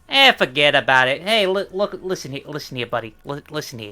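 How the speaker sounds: hammed-up New Jersey tough guy; New Jersey accent. Showing co-host her New Jersey Times, Jersey tough-guy accent. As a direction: lean into a Jersey wiseguy voice. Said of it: "New Jersey Times".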